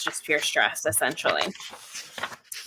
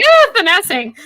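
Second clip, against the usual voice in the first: mock-triggered cry, pitched way up and loud; triggered outcry; 13 semitones higher, 10 dB louder than usual. Reader reliving being triggered by a word in the chapter, high outcry. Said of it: "I was straight triggered".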